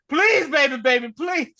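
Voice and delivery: loud, high desperate pleading; mock shouted plea; Dwayne Wayne begging. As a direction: beg at the altar like Dwayne Wayne. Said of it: "Dwayne Wayne when Whitley was about to get married".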